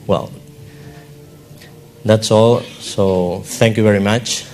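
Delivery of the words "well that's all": ordinary talk, nothing marked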